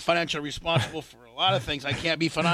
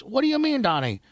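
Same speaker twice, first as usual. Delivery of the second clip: mock wife; 10 semitones higher than usual. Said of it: "It's like".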